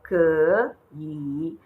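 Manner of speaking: 可以 drawn out with two full dipping tones; dragged third tones. Shown as a demonstration. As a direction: exaggerate the dip on each syllable of 可以. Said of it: "when you have to drag these tones twice".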